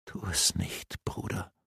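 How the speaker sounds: hushed, tearful whisper, very soft; sobbing whisper. A narrator's reading. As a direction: plead in a whisper through tears. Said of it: "raunte Maeve Faravin schluchzend zu".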